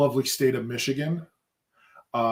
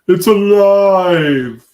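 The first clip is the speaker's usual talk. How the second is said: loud, slow, high mad-scientist cry; mad scientist shout; 8 semitones higher, 14 dB louder, much slower than usual. Acted. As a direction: proclaim it like Frankenstein's creator. Frankenstein-style mock cry.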